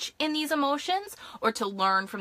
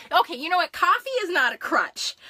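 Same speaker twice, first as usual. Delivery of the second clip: mock defensive; 8 semitones higher, 5 dB louder than usual. Real, not acted. Defends her coffee habit to chat.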